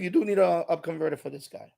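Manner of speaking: usual speaking voice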